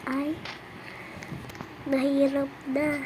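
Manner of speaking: ordinary talk, nothing marked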